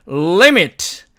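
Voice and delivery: "limit" with a long L, as if 을 comes first; held initial L. Shown as a demonstration. Teacher repeats the correction, long emphatic L. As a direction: hum the L before releasing "limit". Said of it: "리미트가 아니고".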